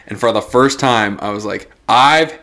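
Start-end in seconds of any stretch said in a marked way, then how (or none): 1.9-2.3 breaks into a high laughing cry of I've had it; fed up, voice cracking up with laughter; a fed-up customer finally snapping; exasperated outburst. Retold or imitated. Retold snapping at McDonald's.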